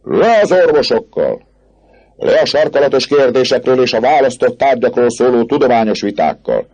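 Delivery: high protest shout; crowd jeering. Acted. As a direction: cry "down with them" like a rioter. Crowd yells against doctors.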